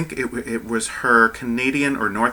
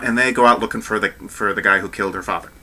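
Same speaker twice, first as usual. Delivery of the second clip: ordinary talk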